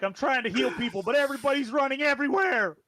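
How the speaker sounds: high, rising mock complaint; exasperated healer. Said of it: "Saizen's like".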